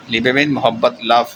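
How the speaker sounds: ordinary talk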